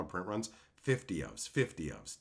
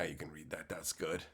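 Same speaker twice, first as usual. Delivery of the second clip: quiet aside; 7 semitones lower, 11 dB quieter than usual. Real, not acted. Checks the sign, then approves.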